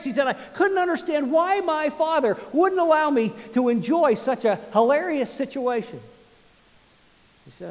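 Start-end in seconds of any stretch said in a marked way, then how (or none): 0.3-6.0 high, loud, hammed-up wonder; exaggerated boyish disbelief, acted out; mock indignant delight. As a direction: proclaim it grandly, pitched up high. Boy mock-puzzled at father's rule.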